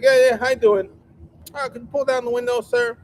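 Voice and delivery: hammed-up friendly driver; goofy high voice. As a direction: put on a silly high voice for the driver. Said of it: "He's like".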